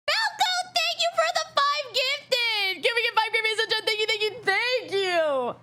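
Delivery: high squealed shout-out, easing down by the end; excited thanks. Real, not acted. Streamer thanking Falco for five gifted, high excited squeal. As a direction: squeal the name high and long, then tumble through thanks.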